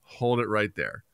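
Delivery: measured parental stop order; mildly stern, slowing on the repeat. Retold or imitated. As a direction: halt the outburst with calm authority. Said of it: "I was pretty stern".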